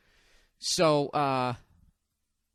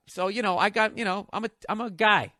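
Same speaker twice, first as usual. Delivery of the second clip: ordinary talk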